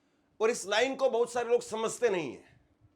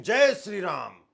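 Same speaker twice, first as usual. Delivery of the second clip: loud, drawn-out threatening chant; forceful, menacing slogan cry; intimidating slogan; 7 dB louder, slower than usual. Shown as a demonstration. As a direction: shout it slowly to scare someone. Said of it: "मतलब आप डरा रहे हो".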